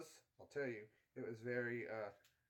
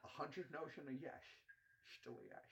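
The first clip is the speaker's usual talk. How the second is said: Sean Connery; 12 dB quieter than usual. Retold or imitated. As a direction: murmur it like Connery. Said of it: "the Sean Connery one".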